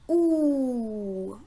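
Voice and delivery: OU as one long drawn-out oo vowel; isolated sound. Shown as a demonstration. Teacher names the sound, OU held long. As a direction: hold OU out slowly as a pure oo. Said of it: "c'est le son".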